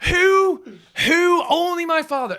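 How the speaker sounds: high, loud, incredulous wail; exasperated outcry. Real, not acted. Son despairing at his father's blunder, loud exasperated outcry. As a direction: cry out in comic disbelief. Outraged at end of anecdote.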